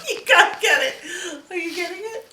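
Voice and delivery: high, loud laughing play voice. Real, not acted. Parent playing with baby, high laughing excitement.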